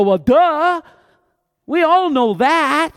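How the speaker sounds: high, loud sarcastic duh, slowing down; mock obvious. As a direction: roll your eyes at the obvious. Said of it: "We look at this and go".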